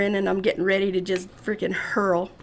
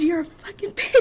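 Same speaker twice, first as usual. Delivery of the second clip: laughing insult; 8 semitones higher than usual. Retold or imitated. Friend insulting her, laughing.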